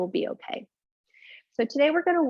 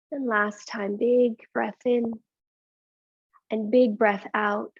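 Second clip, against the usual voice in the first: slow breathing cue; slower than usual. Acted. Guides breathing in yoga.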